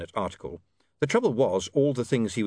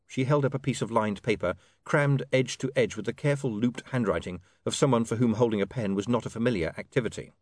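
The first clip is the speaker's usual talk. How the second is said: usual speaking voice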